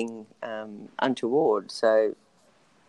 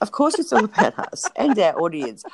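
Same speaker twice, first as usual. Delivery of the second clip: laughing; 8 semitones higher, 4 dB louder than usual. Real, not acted. Laughing while agreeing.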